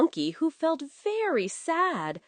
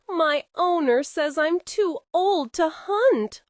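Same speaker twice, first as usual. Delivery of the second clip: mild whimper; 7 semitones higher, 3 dB louder than usual. Acted. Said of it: "whimpered Dog".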